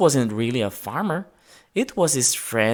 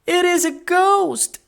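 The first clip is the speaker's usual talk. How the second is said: much higher, louder scream; frightened scream; 4 dB louder than usual. A narrator's reading. A party guest seeing George on the rail, screaming in fright. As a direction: cry it out in terror. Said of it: "someone screamed".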